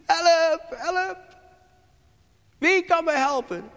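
high, loud desperate cry for help. Retold or imitated. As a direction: cry out in panic for someone to save you. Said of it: "En je schreeuwt". (wanhopige hoge hulpkreet)

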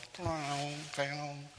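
high, ringing mimicked clang; voiced cowbell clanging; imitated cowbell. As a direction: ring it out like a cowbell. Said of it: "kwam ook met de koebel".

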